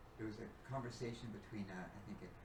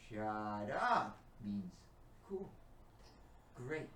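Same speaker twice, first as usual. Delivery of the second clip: explaining slowly; 3 semitones lower, 7 dB louder, much slower than usual. Retold or imitated. Son explains, retold.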